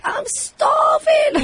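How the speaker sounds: high, drawn-out mock wail; starving guinea pig. Host voicing guinea pigs at dinner time, high drawn-out wail. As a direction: stretch it out in a high little animal voice. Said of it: "so they're like".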